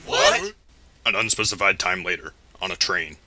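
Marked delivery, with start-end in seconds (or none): 0.1-0.5 high startled squeak; surprised. Acted. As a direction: blurt it out in shock.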